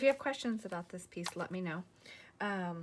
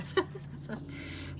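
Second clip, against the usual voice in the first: light, breathy chuckle; real amused little laugh; short laugh; 10 semitones higher than usual. Real, not acted. Amused at her own winging it.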